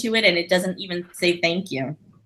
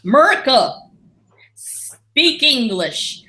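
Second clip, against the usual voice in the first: mock American patriot; 5 semitones higher, 3 dB louder, much slower than usual.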